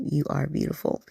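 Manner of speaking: ordinary delivery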